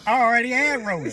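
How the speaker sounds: mock boast.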